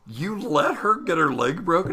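high-pitched, appalled challenge; incredulous retort. Retold or imitated. Retold argument over injured lady.

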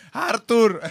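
loud shouted call across the room; calling loudly. Retold or imitated. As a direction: yell the name so he hears. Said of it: "Le habla más fuerte, como si sí le escuchara".

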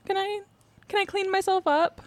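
high, whiny imitation of the suspect; mock her in a high whine. Mocking the suspect's request.

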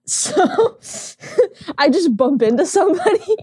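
high laughing words; giggling while speaking. Real, not acted. Laughing at bad roll.

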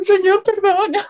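high, slow apologetic plea; teary apology. Retold or imitated. Agent apologizing to the calmed client, high teary. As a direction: squeak out a sorry.

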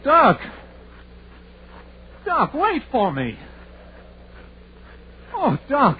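scared to be left behind; frightened calling. Acted. Hooper calling after the doctor in fear, high.

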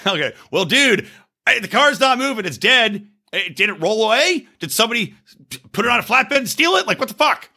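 high, pleading incredulity; rising panic at the absurdity; retold freak-out at a tow driver who can't find the car; exasperated disbelief. Retelling his own freak-out on the phone.

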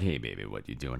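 softly voiced partner; low casual greeting. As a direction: toss off a lazy hello. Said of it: "And Bradford's all like".

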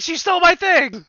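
high, loud hammed-up outcry; over-the-top accusing squeal; mock accusation. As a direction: shriek the accusation for comic effect. Acting out accusation in courtroom.

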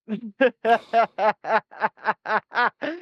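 stupid laugh. Real, not acted. Host giggling at a message from David, silly laugh. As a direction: let a silly, squeaky laugh out. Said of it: "that stupid laugh".

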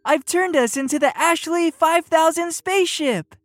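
high, excited announcement; gleeful Penguin. A narrator's reading. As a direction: boast gleefully of your trick. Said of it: "laughed Penguin".

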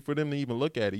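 usual speaking voice

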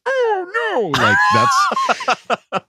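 put-on very high, loud strangled old man; old man kicked in the groin. Shown as a demonstration. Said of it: "do an old man who just got kicked in the groin".

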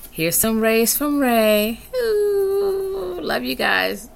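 crying a little while saying goodbye; a real teary farewell; teary sign-off. Real, not acted. Emotional sign-off.

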